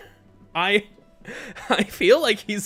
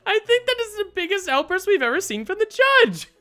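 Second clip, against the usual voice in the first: very high, loud cartoonish astonishment; astonished outburst; 7 dB louder than usual. Onlooker reacts to the judge's outburst, high astonished cry. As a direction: exclaim it high and loud, hammed up.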